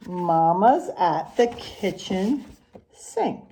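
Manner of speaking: sing-song rhyming picture-book read, unhurried; reading to children. Acted. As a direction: read the rhymes to the class with a lilt. Teacher reads picture book aloud.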